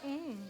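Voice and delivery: low, soft, savoring hum; appreciative hum. Retold or imitated. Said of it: "she'd be like".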